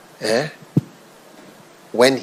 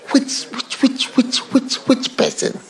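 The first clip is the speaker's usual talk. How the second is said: insistent high repeated question; 11 semitones higher than usual. Real, not acted. Preacher challenging the congregation, urgent high insistence. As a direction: pitch up and push the question.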